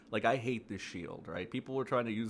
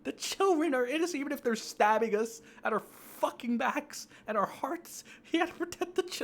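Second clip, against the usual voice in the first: much higher, mocking whine with a laugh in it; mocking whiny voice; 4 dB louder than usual. Mocking the child-protecting crowd, high whiny voice. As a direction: whine it like a hand-wringing scold. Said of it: "same stupid mindset".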